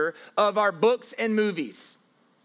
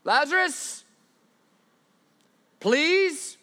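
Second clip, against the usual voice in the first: mock pleading; 7 semitones higher, much slower than usual. Mock God begging Lazarus.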